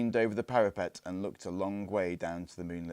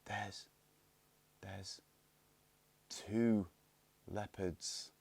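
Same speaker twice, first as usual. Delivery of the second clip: much softer, halting whisper; frozen with fear, barely breathing it; shaky whisper; 7 dB quieter, much slower than usual. A narrator's reading. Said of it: "gave a shaky whisper".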